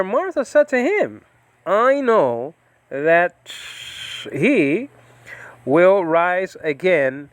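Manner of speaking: a Bible teacher re-reading Martha's reply slowly; scripture reading. Acted. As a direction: read it out deliberately, phrase by phrase.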